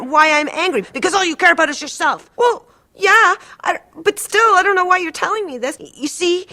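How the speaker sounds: patient fighting with her therapist, high, loud, close to crying; upset shouting. Retold or imitated. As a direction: pour out the grievance, voice cracking. Quarrel with the therapist.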